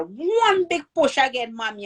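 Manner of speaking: retold urging. Helper to mother in labor, retold urging to push.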